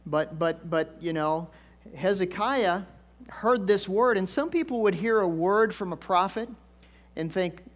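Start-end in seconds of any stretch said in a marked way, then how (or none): none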